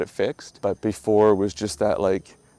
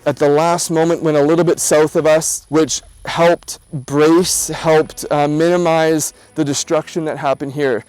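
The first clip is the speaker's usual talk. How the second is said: louder, higher presenter read over music; vlog voiceover; 6 semitones higher, 8 dB louder than usual. Real, not acted. Vlogger telling viewers about the hurricane, louder upbeat voiceover. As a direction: narrate to camera like a travel host.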